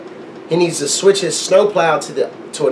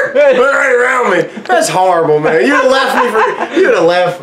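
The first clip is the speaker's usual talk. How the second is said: laughing; 6 semitones higher than usual. Real, not acted. Laughing protest at friend.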